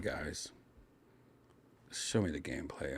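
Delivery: ordinary talk, nothing marked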